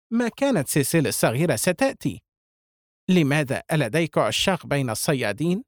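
usual speaking voice

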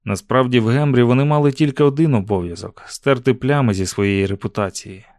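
plain reading voice

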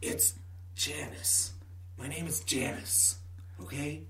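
hushed whisper close to the ear. Acted. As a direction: whisper the secret name. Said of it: "whisper into your ear".

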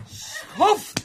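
high loud yell of rage; furious shout. Acted. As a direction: spit the insult out in fury. Fight, striking the man.

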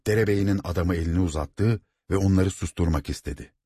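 usual speaking voice

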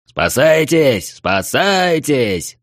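slow drawn-out alarm cry; shouting. A narrator's reading. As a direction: yell a warning to flee. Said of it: "закричал он".

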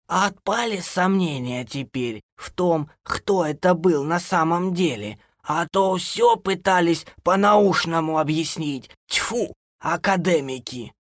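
put-on high, slow old woman's voice; scornful granny. A narrator's reading. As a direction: scold the know-it-alls slowly. (бабушка ворчит, высоко и медленно)

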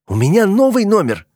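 high-pitched indignant shout; worked-up outburst, pitched high; exasperated yell. A narrator's reading. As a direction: yell it in frustration. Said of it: "заорал, махая руками".

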